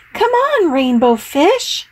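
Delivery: high bright calling voice. A narrator's reading. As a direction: call out cheerfully. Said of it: "they called".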